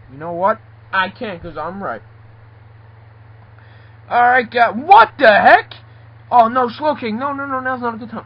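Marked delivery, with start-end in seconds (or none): 4.1-8.2 loud, high dismay; alarmed shock. Acted. Character pleading with Slowking it's a bad time, high alarm. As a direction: burst out aghast, then protest quickly. Walks in on an awkward scene.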